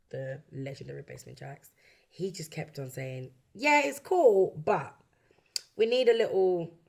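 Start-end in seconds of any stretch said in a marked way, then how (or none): 3.5-6.7 mock tactful objection. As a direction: imitate someone hedging a criticism. Retelling producer's repeated polite objection.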